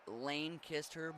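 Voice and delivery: "Lane kissed her" slowly, word by word; sounded-out pronunciation. Shown as a demonstration. Streamer reading out a pronunciation tip, slowly sounded out. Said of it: "but very fast".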